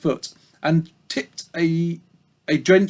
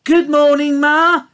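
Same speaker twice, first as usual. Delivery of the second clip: very high, eager little piglet; sweet high piggy voice greeting the old ewe; Babe's piglet voice; 12 semitones higher, 7 dB louder than usual. A narrator's reading. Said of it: "he said".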